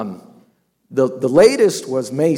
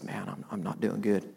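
downcast confession; 8 semitones lower, 13 dB quieter than usual. Retold or imitated. Retelling a troubled man's confession.

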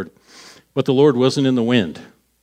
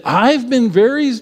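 high, louder whiny start; exasperated whine; 10 semitones higher, 5 dB louder than usual. Acted. Elijah starting his same excuse again, whiny.

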